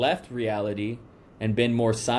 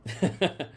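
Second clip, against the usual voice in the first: a short, high real laugh; 6 semitones higher, 3 dB quieter than usual. Real, not acted. Interviewer laughing at the reply about nature. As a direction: laugh lightly and high at the joke.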